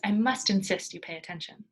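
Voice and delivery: plain reading voice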